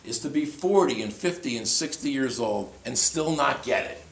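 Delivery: loud, high sermon voice; fiery pulpit delivery; preaching at full force. Acted. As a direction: hammer home what is truly sad. Preacher voicing Tommy's realization.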